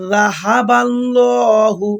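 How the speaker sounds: জাহাবাল্লাহু chanted slowly with a thin la; tajweed recitation. Shown as a demonstration. Teacher demonstrating the light lam, slow chant. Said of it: "বলা লাগবে ল".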